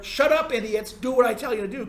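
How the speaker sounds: arrogant contempt. Retold or imitated. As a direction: bark it down at the masses like a smug expert. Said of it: "kind of tone".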